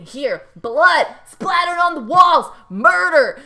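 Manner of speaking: mock rant. Imitating Naya going off on Sarah, building furious rant. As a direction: tear into her like a mean housemate. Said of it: "They're like".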